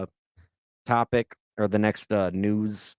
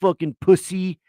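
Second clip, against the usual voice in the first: a mildly higher, louder taunting sneer; lightly imitated mocking jeer; mocking girl; 6 semitones higher, 4 dB louder than usual. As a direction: tease him like a snotty twenty-year-old. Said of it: "she's making fun of me and talking shit".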